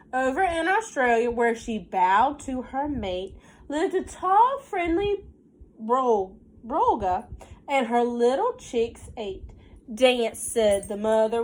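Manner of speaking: lilting storybook read, slowed on the rhymes; reading to children. Acted. Teacher reading a counting rhyme to her class, sing-song storybook voice. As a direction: read the rhyme aloud to the little ones. Reads picture book aloud.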